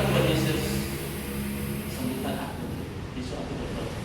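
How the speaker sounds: low, soft, fading mumbled prayer; weak prayer. Retold or imitated. Sick man praying to Jesus at bedtime, weak and hushed. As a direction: let it sink away softly, drained.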